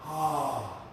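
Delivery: long open-mouthed sighing exhale; audible yoga breath on "ah"; breathy "ha" exhale. Shown as a demonstration. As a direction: let the breath out as a soft "haa" from the back of the throat. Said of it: "making that little ha sound".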